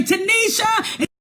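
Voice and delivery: God calling Tanisha down the stairs. Acted. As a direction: holler the name across the house.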